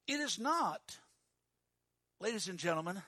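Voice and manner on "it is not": normal talking voice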